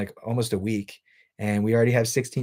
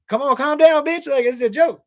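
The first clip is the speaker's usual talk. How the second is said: much higher and louder teasing voice; playful mock squeal; 13 semitones higher, 5 dB louder than usual. Teasing a fan who got upset, high loud mock voice. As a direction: squeal it like you're teasing a friend.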